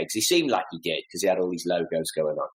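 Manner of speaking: normal talking voice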